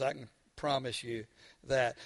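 ordinary talk, nothing marked

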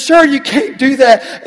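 high, loud and slow objection; protesting clerk. Retold or imitated. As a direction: play the clerk calling after him to stop. Clerk scolding in story.

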